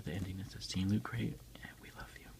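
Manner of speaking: ordinary delivery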